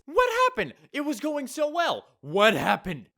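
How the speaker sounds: very high, loud despairing "What happened?"; shrill despairing outcry; exasperated wail. Real, not acted. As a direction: throw your hands up in dismay. Mock despair at the story.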